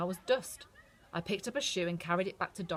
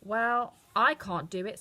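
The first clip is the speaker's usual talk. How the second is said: louder, slow kindly witch; Good Witch; 4 semitones higher, 7 dB louder, slower than usual. A narrator's reading. The witch answers Dorothy, slow and kind. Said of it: "said the Good Witch of the North".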